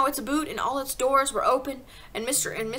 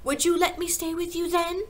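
put-on high, hopeful child's voice; excited little girl pleading; eager cry; 7 semitones higher, 5 dB louder than usual. A narrator's reading. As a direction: press the hope eagerly, pitched up. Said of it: "Matilda cried eagerly".

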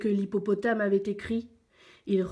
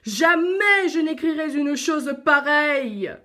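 loud, raised, indignant roar; roaring lion; 6 semitones higher, 8 dB louder than usual. A narrator's reading. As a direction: roar the refusal as an outraged lion. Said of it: "rugit le lion". (lion qui rugit, indigné)

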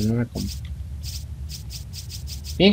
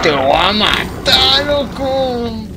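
slow mock menacing villager; mock villager threat; 7 semitones higher, much slower than usual. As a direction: drag it out like the enemy. Echoing the enemy's shout.

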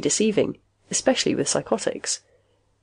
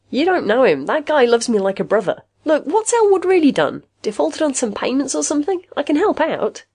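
high-pitched, incredulous argument; protesting; 9 semitones higher than usual. A narrator's reading. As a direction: plead your friend's case to a doubter. Friend argues against the accusation.

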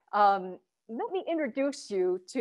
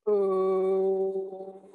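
hara voice vowel; 2 semitones lower than usual. Shown as a demonstration. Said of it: "Try oo".

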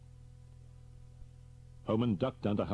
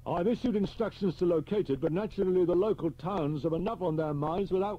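higher, louder brisk senior officer; blunt superintendent; 11 semitones higher, 5 dB louder than usual. Acted. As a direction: clip through it like a blunt chief. Superintendent continues briefing.

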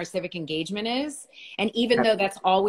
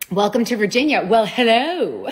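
gleeful welcome; 8 dB louder than usual. Real, not acted. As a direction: sing out the welcome with a laugh. Greeting viewers from Virginia.

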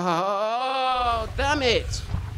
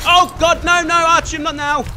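panicked shout; 6 semitones higher, 5 dB louder than usual. Real, not acted. Companion dying mid-fight.

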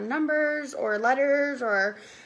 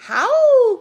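high, very loud howling call; werewolf mother's cry; 11 semitones higher, 11 dB louder than usual. A narrator's reading. Mother werewolf to her wee wolfies, crying out. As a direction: howl the word out high and loud. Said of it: "cried the ma".

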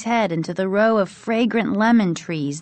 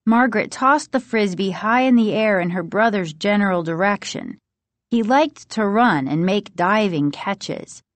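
ordinary delivery

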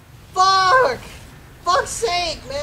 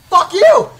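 loud, higher burst of cursing; angry shout; 4 semitones higher, 8 dB louder than usual. Real, not acted. Gamer cursing the enemy, angry shout. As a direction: shout the curse at full force.

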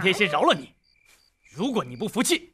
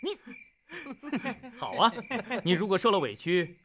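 ordinary talk, nothing marked

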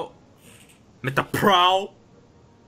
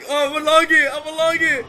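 hyped gamer bro; 9 semitones higher, 6 dB louder, faster than usual. Real, not acted. Hyped during chaotic match.

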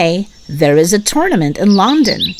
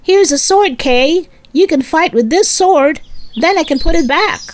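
put-on much higher, bright boy's voice; young Arthur giving Kay the sword; excited young Arthur; 11 semitones higher than usual. A narrator's reading. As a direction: hand it over happily like an eager boy. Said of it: "Arthur takes the sword to Kay and says".

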